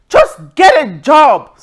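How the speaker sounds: loud, slow, scornful get a job; contemptuous scolding; scornful order. Retold or imitated. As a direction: bark it down the line at him. Dismissing scammer's threat.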